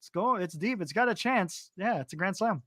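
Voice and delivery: mock announcer who can't get excited; underwhelmed mock call. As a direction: tease with an anticlimactic home run call. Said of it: "That's all you did was like nothing".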